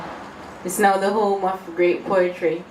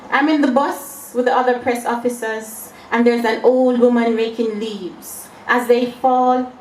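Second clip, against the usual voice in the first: raised, measured verse reading; poetry recital; 4 semitones higher, 3 dB louder than usual. Acted. Poet begins her poem Leaves, poetry recital. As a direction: recite it as an unfolding scene.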